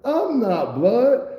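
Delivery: high, slow, pleading no; scared denial. Retold or imitated. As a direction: stretch the denial up high, protesting.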